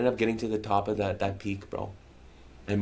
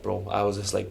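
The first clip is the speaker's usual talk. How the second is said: normal talking voice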